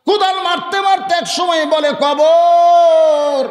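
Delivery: high shouted chant, last word held very long; shout-sing the final word; full-force preaching cry. Acted. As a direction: build to a loud, ringing call. Preacher cries out to the grave.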